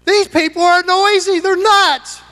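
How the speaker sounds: high, scandalized little voice; shocked quiet lady. Pretend. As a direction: play a prim church lady overwhelmed by noise. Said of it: "They're gonna say".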